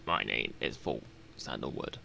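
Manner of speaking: very low-pitched, solemn freedom seeker; Volk Sandalwood. Acted. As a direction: drop deep down for a stately pledge of liberty. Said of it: "forgot to do his voice".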